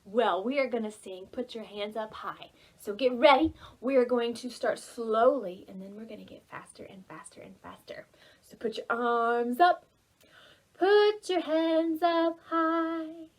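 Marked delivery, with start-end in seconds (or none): none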